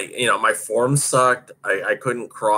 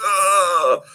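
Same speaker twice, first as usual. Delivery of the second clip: a sharp, higher, louder grunt of relief; exhausted grunt; 8 semitones higher, 4 dB louder than usual. Retold or imitated. Skater hearing the last lap, loud spent grunt. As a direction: let it burst out as you cross the line. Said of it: "I was just like".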